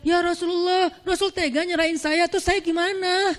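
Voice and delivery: high, pleading mock protest; a half-joking whiny plea; pleading wife. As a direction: play the wife who begs and complains. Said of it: "Dia nggak bilang".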